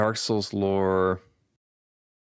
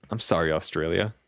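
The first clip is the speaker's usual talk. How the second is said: ordinary talk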